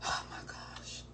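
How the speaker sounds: breathy whispered aside; a hushed, horrified whisper to the camera. Real, not acted. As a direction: whisper it in mortified disbelief. Recalling eating the chicken sausage.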